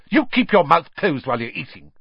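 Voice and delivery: sharp, high, loud telling-off; snapped scolding. A narrator's reading. Mother cutting off her son at the table, snapping. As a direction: snap at a cheeky child. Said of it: "Missus Weasley snapped".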